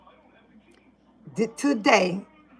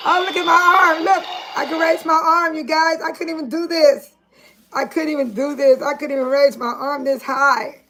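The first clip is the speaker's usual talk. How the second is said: excited; 5 semitones higher than usual. Real, not acted. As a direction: show off the arm with delight. Thrilled she can raise arm.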